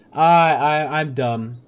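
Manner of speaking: loud burst calling himself dumb; mock self-scolding. Player realising his mistake, loud mock outburst. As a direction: blurt out your own foolishness.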